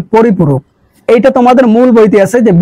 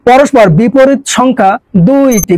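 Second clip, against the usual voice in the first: পরস্পর বিপরীত সংখ্যা দুইটি spelled out slowly word by word; slow teacher dictation; 2 semitones higher, slower than usual. Shown as a demonstration. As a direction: dictate it slowly so students can write. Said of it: "আরেকটু জানবা যে".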